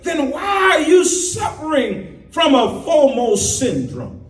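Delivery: high, ringing challenge; preaching climax. Acted. Preacher challenging the congregation about fomo. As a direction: call them out from the pulpit.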